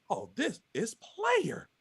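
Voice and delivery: slow, drawn-out, savoring the words; impressed and cool. Retold or imitated. Guy in a fishing kayak, slow, impressed drawl. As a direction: lean back and let it roll out slowly.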